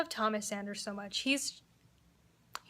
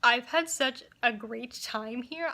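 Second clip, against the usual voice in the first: ordinary delivery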